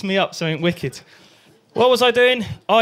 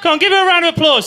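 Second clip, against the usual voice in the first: rallying call; 9 semitones higher, 4 dB louder, faster than usual. Acted. Rallying the crowd.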